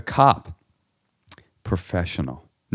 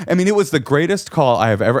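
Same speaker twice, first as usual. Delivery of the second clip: ordinary talk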